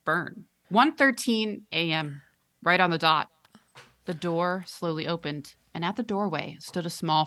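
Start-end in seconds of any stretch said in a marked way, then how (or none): 0.7-3.3 spooky storyteller voice. A narrator's reading. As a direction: draw out the time ominously. Ominous story opening.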